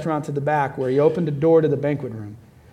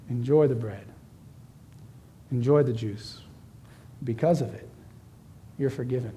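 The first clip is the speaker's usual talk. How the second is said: tender; 3 semitones lower, 6 dB quieter than usual. Acted. Voicing the Savior at communion.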